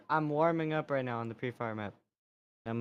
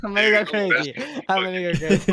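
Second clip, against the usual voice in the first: frustrated yell; 7 semitones higher, 6 dB louder than usual. Real, not acted. Driven mad by echo.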